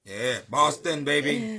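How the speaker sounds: loud, slow, stretched hype for Boston; hyped shout-out. Real, not acted. Host's guest hypes up Boston, loud and drawn out. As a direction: cheer the city like a crowd. Hyping up his hometown guest.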